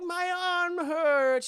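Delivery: put-on high, very slow childish whimper; whiny little girl. Esther complaining to her father, high slow whimper. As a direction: drag it out like a hurt kid.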